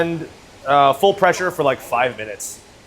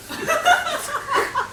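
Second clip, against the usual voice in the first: laugh; 6 dB quieter than usual. Real, not acted. Laughing at friend.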